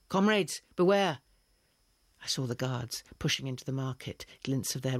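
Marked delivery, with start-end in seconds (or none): 0.1-1.2 loud, higher call of alarm; a distant cry warning the traders; shouting a warning. Acted. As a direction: yell it across the market.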